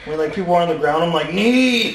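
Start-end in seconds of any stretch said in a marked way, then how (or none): 1.3-1.9 loud, high mock shout; imitated ringside yell; calling out. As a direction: shout it like a fight spectator. Re-enacts shouting advice from the crowd.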